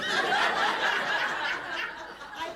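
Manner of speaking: long high laugh; real high-pitched laughter. Real, not acted. Laughs at interruption during story.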